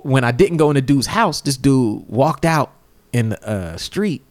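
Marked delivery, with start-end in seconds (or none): none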